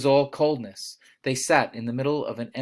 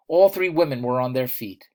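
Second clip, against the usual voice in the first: plain reading voice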